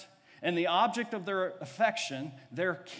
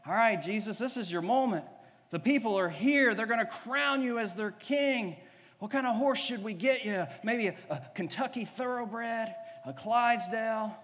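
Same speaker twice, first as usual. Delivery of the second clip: eager disciples; 5 semitones higher than usual. Acted. Disciples voiced, urging Jesus.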